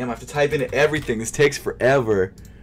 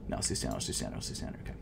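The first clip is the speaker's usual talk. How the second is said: muttering; 17 dB quieter, much faster than usual. Real, not acted. Muttering choices while typing.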